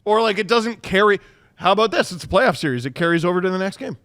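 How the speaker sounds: normal talking voice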